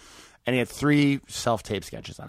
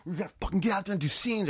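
pep talk; 8 semitones higher than usual. Retold or imitated. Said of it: "she was like".

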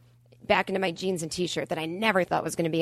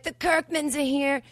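excited announcing; 6 semitones higher, slower than usual. Retold or imitated. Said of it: "She's like".

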